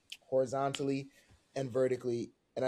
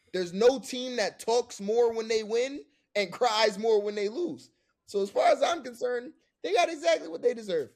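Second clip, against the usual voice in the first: heated rant; 9 semitones higher, 6 dB louder than usual. Real, not acted. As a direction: rant it with real heat. Venting hatred of rival team.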